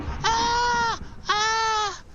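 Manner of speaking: very high falsetto "ah" shrieks; tiny spider scream. Acted. Spider about to be stepped on, tiny high mock scream. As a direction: squeal like a tiny creature in danger. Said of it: "the little spider was like".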